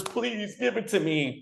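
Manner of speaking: high-pitched plea to God; imploring, acted-out plea; pleading prayer. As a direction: beg the universe, pitched well up.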